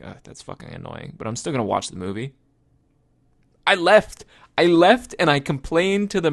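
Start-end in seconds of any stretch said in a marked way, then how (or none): 0.0-1.1 under-the-breath grumble, almost whispered; muttered complaint. Retold or imitated. Annoyed moviegoer muttering about chatty neighbours, whispered grumble. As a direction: mutter it so only you hear. Annoyed at talkers beside you.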